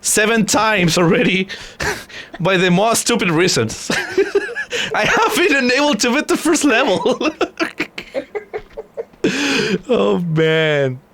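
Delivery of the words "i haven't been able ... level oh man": high, laughing through the words; helpless real laughter while confessing. Real, not acted. Streamer admitting his failures to friends, laughing. Laughing at own repeated deaths.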